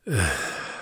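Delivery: soft exasperated sigh into the phone; exhale. A narrator's reading. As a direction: let out a breathy, falling sigh. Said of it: "Harry exhaled into the phone".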